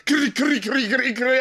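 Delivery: high, squawked chopped vocal sample; mock repetitive sample squawk; sample imitation. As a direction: mimic a stuttering record chop. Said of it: "then it just went into".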